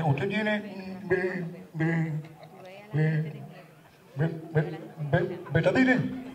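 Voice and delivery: stammered, slow, nasal mock voice; nasal stutterer. Nasal customer stammering for betadine at pharmacy, mock voice. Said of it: "un chaval que es gangoso".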